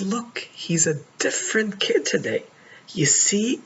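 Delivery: hint of an excited child; daughter's voice. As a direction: play his daughter telling her father the good news. Daughter quoted, excited report.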